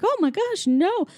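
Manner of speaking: high, swooping faux-ladylike surprise; man posing as lady. Disguised fugitive asking pretend husband, mock-ladylike. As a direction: coo it like a pretend wife. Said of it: "Pee-wee's like a lady".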